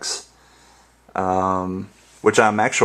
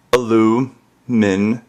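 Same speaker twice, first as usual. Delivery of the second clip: ordinary delivery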